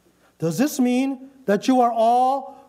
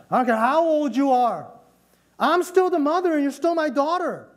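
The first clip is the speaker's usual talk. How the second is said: higher scolding talk-down; a mother pulling rank over her grown daughter; condescending mother; 6 semitones higher than usual. Retold or imitated. Mother lecturing her grown daughter, condescending. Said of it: "have you heard that kind of attitude?".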